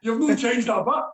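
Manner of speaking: put-on high, loud delighted hello to an old school pal; surprised joy at spotting Brenny; delighted greeting. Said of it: "And he went like".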